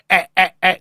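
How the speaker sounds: choppy robotic syllables. Shown as a demonstration. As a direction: play a toy robot beeping out syllables. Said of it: "la sa fare la voce del robot?".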